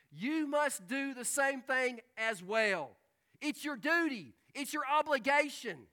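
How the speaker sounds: slow, loud and high, each phrase hammered; emphatic preaching. Acted. Preacher urging the congregation to serve, slow and forceful. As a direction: press the duty home.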